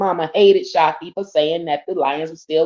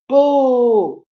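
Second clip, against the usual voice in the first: higher, playful booing; mock boo; 8 semitones higher, 4 dB louder than usual. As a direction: boo him like a fan. Booing the quarterback.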